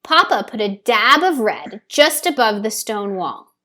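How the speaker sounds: ordinary delivery, nothing marked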